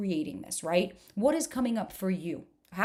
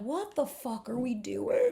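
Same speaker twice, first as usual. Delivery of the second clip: exasperated disbelief; 3 semitones higher, slower than usual. Retold or imitated. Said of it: "And I'm like".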